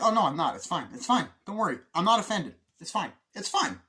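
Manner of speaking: high, loud mock reassurance; protesting too much. Acts out insisting he's not offended.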